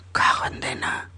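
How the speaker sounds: susurro fastidiado; maldición entre dientes. Acted. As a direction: soltarlo en un susurro rabioso. Maldice el gol en voz baja.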